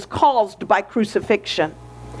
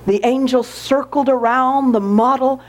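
ordinary talk, nothing marked

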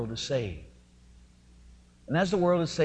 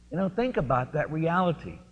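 ordinary talk, nothing marked

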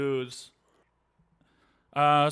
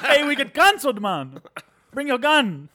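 put-on higher, louder, laughing Jamaican accent; mock Jamaican accent, laughing; 11 semitones higher, 6 dB louder, slower than usual. Podcaster doubling down on the Jamaica joke, in a laughing mock Jamaican accent. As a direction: cackle through the Jamaican bit. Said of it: "it's very racist".

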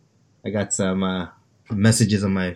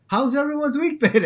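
bright host question; 13 semitones higher than usual. Pretend. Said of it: "but like".

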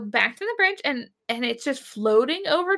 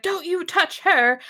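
mock protective warning; 6 semitones higher than usual. Acting out Scotty charging in.

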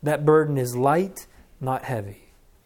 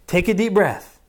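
put-on louder, insistent parent calming a screaming child; firm parent over a tantrum; 4 semitones higher, 5 dB louder than usual. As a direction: raise your voice to cut through the yelling. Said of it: "they're just yelling and we're saying".

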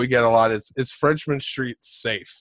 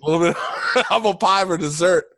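laughing; 4 semitones higher, faster than usual. Real, not acted. Echo with laugh.

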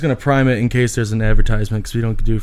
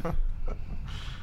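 breathless wheezing laugh; wheezy laugh; 14 dB quieter than usual. Real, not acted. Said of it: "You're wheezing".

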